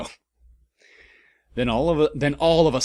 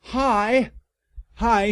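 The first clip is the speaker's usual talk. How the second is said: high greeting; 11 semitones higher, 4 dB louder than usual. Acted. Lovers greeting.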